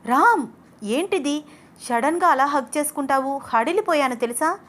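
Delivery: jolted by a sudden hug from behind, she cries out; startled. A narrator's reading. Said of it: "ఉలిక్కిపడింది అనన్య". (ఉలిక్కిపడి కంగారుగా, గట్టిగా)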